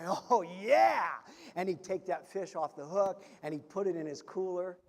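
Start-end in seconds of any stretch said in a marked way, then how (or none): 0.1-1.1 louder, thrilled cheer; happy fisherman's cheer; excited. Acted. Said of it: "excitedly he'd say".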